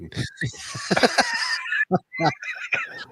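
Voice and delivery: long, high peal of laughter; delighted laugh. Real, not acted. Laughing as she calls Paulette a geek too. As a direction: laugh with glee at a fellow fan.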